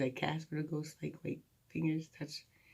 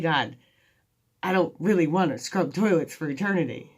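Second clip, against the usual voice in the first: mock complaint; 3 semitones higher, 9 dB louder than usual. Joking complaint to God.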